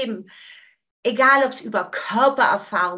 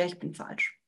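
softer, barely voiced confession; whispered belief; 4 semitones lower, 13 dB quieter than usual. Shown as a demonstration. Teacher to listeners, whispering the old belief. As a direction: whisper it as the hidden conviction.

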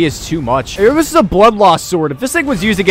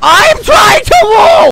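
very high, slow screamed protest; screeching, stretched-out frustration; frantic scream; 7 dB louder, much slower than usual. Real, not acted. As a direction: scream every word at the top of the voice. Repeats louder as dodge fails.

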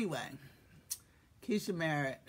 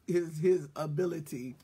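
usual speaking voice

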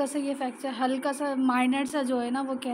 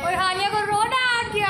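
excited; 7 semitones higher, 9 dB louder than usual. Real, not acted. Daughter started crying.

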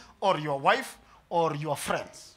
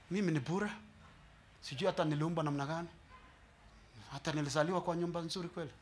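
soft, fast moaning of someone who thinks they are cursed; a quiet, hurried lament of the unlucky; self-pitying complaint; 10 dB quieter, faster than usual. Retold or imitated. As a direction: mutter your woes as if under a curse. Pastor voices self-pitying complainer.

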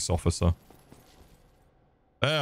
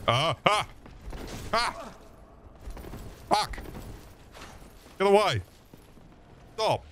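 panicked yelps; 11 semitones higher, 5 dB quieter than usual. Real, not acted. Panicked under enemy attack.

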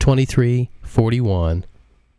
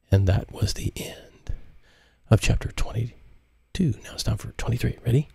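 soft whisper; 2 semitones higher, 6 dB quieter than usual. A narrator's reading. Closing the chapter.

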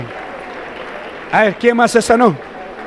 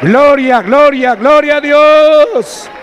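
rousing shout; 5 semitones higher, 3 dB louder, much slower than usual. Real, not acted. Rousing congregation to applaud.

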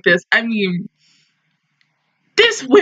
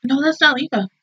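ordinary talk

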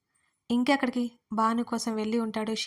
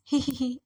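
goofy little hehehe; silly giggle; 3 semitones higher, slower than usual. Retold or imitated. Caught bragging, she giggles sheepishly to her friend. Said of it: "వెర్రిగా నవ్వు నవ్వి".

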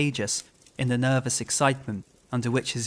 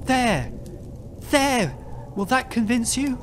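triumphant cry; 10 semitones higher, slower than usual. A narrator's reading. Haunted man to doubting clergyman, high triumphant cry. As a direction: cry out in horrible vindication. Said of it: "with a horrible smile of triumph".

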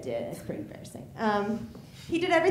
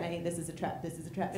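nervous muttering; 4 semitones lower, 10 dB quieter than usual. Retold or imitated. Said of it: "He's like".

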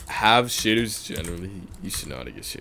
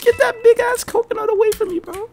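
very high, drawn-out shriek; panicked scream; 11 dB louder than usual. Real, not acted. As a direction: scream in terror at a game enemy. Screaming as enemy gets close.

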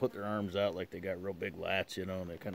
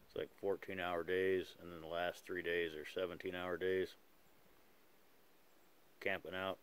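talking around a pipe. Real, not acted. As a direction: pipe clenched in teeth. Seen 0: Pipe stem clenched in mouth while talking throughout, smoke visible.

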